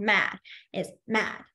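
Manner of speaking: ordinary talk, nothing marked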